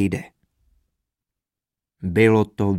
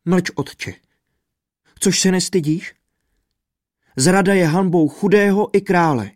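higher, sharp rebuke of her father; scolding daughter; 6 semitones higher, 4 dB louder than usual. A narrator's reading. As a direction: shame him into silence. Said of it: "sama ho okřikla".

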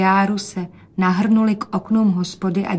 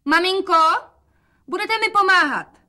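put-on much higher, louder girl's call; a bright girlish plea up high; young daughter's voice; 11 semitones higher, 4 dB louder than usual. Rozetka calls her mother for help in a much higher girl's voice.

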